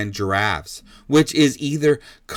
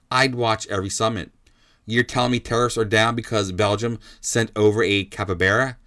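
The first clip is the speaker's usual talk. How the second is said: ordinary talk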